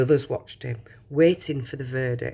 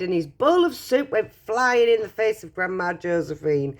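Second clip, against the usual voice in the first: ordinary delivery, nothing marked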